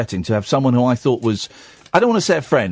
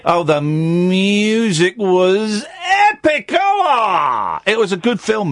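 excited rave; 8 semitones higher, much slower than usual. Real, not acted. Raving about film.

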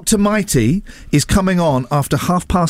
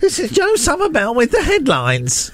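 much higher, squeaky newsreader voice; high mock headlines voice; high-pitched Joe impression; 9 semitones higher than usual. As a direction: pitch it right up to mimic Joe. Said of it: "You're not high-pitched enough".

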